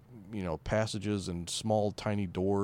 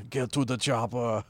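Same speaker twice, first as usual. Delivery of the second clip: put-on Schwarzenegger accent; 3 semitones higher than usual. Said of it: "German accent".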